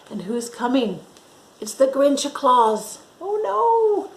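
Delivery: ordinary delivery, nothing marked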